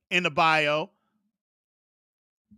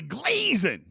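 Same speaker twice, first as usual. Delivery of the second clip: drawn-out, swooping "glazing"; own spin on slang. Pretend. Forty-eight-year-old host trying young slang, playfully exaggerated. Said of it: "that's how I have to say it".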